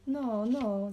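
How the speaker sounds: high, loud warning; flaring up in protest; heated indignation. A narrator's reading. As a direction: bristle and scold. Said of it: "zacietrzewiłem się".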